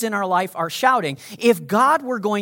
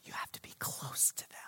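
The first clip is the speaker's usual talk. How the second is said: very soft, confidential whisper; 5 semitones lower, 24 dB quieter than usual. Shown as a demonstration. Preacher showing why whispering needs closeness, whisper. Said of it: "if you're gonna whisper to somebody".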